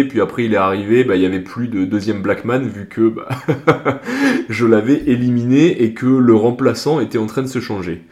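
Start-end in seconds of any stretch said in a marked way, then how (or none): 3.3-4.4 real high burst of laughter; short laugh. Real, not acted. The speaker laughing while telling his story, real laugh. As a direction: laugh at the memory.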